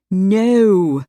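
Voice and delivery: loud horrified cry; cried in horror. A narrator's reading. Jess cries out in horror at her sister's plan. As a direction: stop her cold, appalled. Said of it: "Jess cried in horror".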